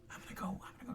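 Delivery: fast unvoiced whisper; NPC whisper. NPC scout whispering before slipping away, fast whisper. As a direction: hurry the words out in a whisper.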